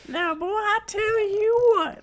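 very high, slow put-on voice; old drawling voice. Both trading silly put-on voices.

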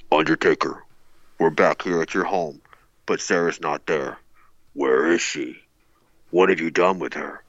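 low, creepy stalker voice; lightly voiced menacing stalker; heavy breathing phone whisper. Shown as a demonstration. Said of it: "I'll try my Stalker voice here".

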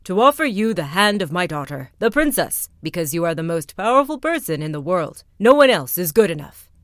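loud, puffed-up royal proclamation; self-important king. Acted. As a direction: boast like a tiny proud king. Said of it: "very importantly".

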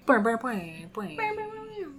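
playful high bouncing boings; boing sounds. Pretend. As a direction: bounce it like a cartoon spring. Cheering patrons with bounce sounds.

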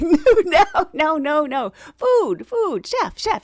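high, loud, amused protest; animated imitation of someone correcting her; emphatic correction. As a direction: insist in rapid repeats to clear up a mix-up. Acted correction of her misunderstanding.

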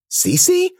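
high-pitched, questioning name; squeaky surprised check; startled question. A narrator's reading. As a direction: ask in surprised disbelief. Startled to recognise her.

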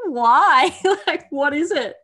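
mock baffled why. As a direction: toss it back in disbelief. Retelling her baffled reaction.

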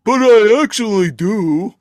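menacing and hammed-up; fierce Scold. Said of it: "And she's like".

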